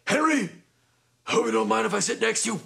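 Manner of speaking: high, quick character voice; put-on voice, pitched well up and hurried; acted seatmate greeting. Lemmy asking to take the last seat, high imitated voice.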